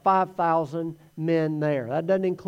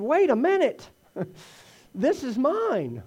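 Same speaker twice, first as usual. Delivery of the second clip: put-on protective kid; 7 semitones higher than usual. The boy whose lunch Andrew takes, guarding it. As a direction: voice pitched up, "This is mine" slowed and possessive. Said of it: "the kid's probably thinking".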